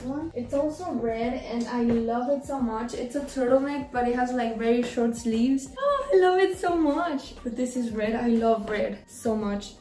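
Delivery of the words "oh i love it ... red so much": usual speaking voice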